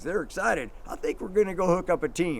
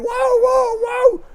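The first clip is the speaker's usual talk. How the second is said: high, loud pleading whoa; frantic high whoa; 10 dB louder, much slower than usual. Retold or imitated. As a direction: call out desperately while dragging. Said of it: "and I'm saying".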